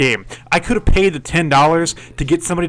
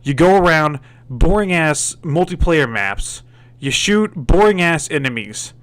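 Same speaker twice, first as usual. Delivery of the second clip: usual speaking voice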